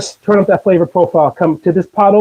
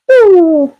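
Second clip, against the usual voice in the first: high, louder delighted ooh; excited ooh; 10 semitones higher, 4 dB louder than usual. Real, not acted. Excited cook tasting food.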